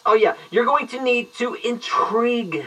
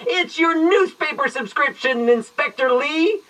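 higher, punchy announcer burst with NEWSPAPER stressed; spring the punchline like a game-show host; boisterous payoff to the riddle; boisterous reveal; 7 semitones higher than usual. Shown as a demonstration. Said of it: "Then return boisterously".

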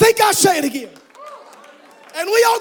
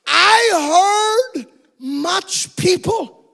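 slow, shouted preaching of scripture; fiery reading of Revelation; 2 semitones higher than usual. Acted. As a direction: proclaim it like thunder from heaven. Preacher reads Revelation at full force.

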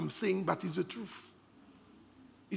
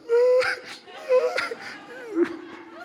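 crying laugh. Real, not acted. Laugh while recalling dead father.

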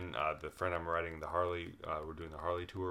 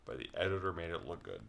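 ordinary talk

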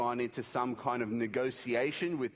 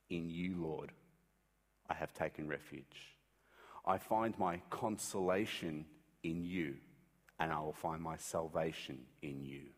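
low, much softer trusting prayer; hushed prayer; 7 semitones lower, 6 dB quieter than usual. Retold or imitated. Jesus praying the psalm from the cross, hushed and low. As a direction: pray it quietly, fully trusting. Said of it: "His choice was".

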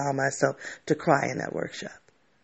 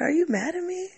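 much higher, small, anxious voice; imitating worried friend. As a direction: mimic her timid question. Friend asks while driving back.

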